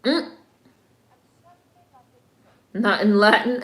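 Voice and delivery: usual speaking voice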